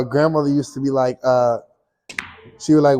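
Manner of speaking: ordinary delivery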